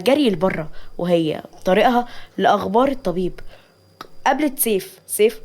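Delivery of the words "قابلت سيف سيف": ordinary delivery, nothing marked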